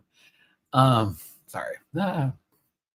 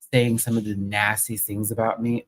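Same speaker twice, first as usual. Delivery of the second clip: ordinary talk, nothing marked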